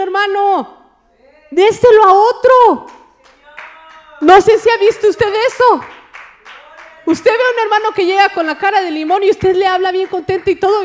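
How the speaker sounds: fiery preacher calling out the congregation; full-force preaching. Acted. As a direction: preach it hard at the whole church.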